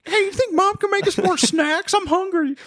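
very high cartoon boy; whiny kid voice.

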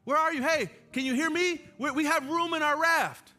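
loud, high shouts across water; calling out. Pretend. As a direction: call out to people in the water. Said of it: "I would say".

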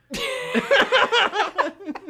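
squealing laugh; high laugh. Real, not acted. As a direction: burst out shrieking with laughter.

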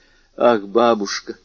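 ordinary delivery

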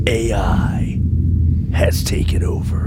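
hushed dramatic trailer whisper. Acted. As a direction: breathe the title slowly. Dramatic trailer title reveal.

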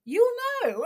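mother's breezy high voice brushing off the question; mum's airy reply. Retold or imitated. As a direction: toss it off like an unbothered mother. Mum's vague cooking advice voiced.

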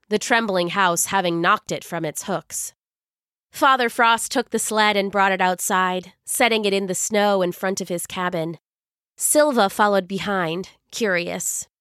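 ordinary delivery, nothing marked

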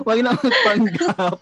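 slow, laughing jab; laughing slowly, teasing a friend for putting on English; laughing tease. Real, not acted. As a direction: tease through a real laugh. Teasing friend about faking English.

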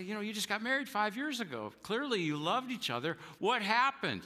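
incredulous question. Retold or imitated. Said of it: "And I'll say".

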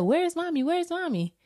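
put-on high, anxious little boy calling for mommy; small boy calling out for his mother; child's worried voice. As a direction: play the scared little son searching. Mom voices her son looking for her.

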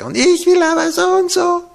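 imitating a defiant screaming baby; defiant child. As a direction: whine like a stubborn toddler. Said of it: "das da schreit und brüllt und sagt".

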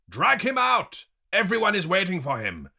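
high, loud stern order; commanding shout from above. A narrator's reading. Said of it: "the stern voice says from above".

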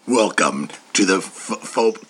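very low, gravelly-free growl of a caped hero; deep Batman voice. Shown as a demonstration. Recording a podcast intro as a deep Batman. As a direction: drop way down into a brooding hero rasp. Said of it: "deep Batman voice".

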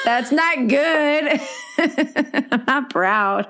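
squeaky laugh through the words. Real, not acted. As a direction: laugh it out, high and slow. Laughing at own confession.

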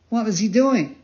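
high, eager question; anxious puppet boy. Acted. Pinocchio asking the pigeon about his father, high and eager. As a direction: ask urgently in a high child's voice.

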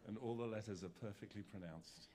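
a hint of posh BBC English; BBC English accent. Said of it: "talk with my best B B C accent".

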